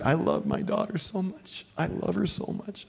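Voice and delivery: high-pitched, choked-up pleading; imitated tearful dad. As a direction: act a father confessing love through tears. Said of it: "dads just through tears say".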